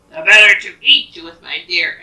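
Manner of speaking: storybook wolf booming out his threat; big bad wolf. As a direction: pounce on the line. Wolf's menacing reply before pouncing.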